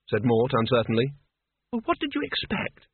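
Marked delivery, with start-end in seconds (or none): 1.7-2.9 high sharp snap, much higher pitch; snapped. A narrator's reading. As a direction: snap it at him, impatient. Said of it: "snapped Isabel".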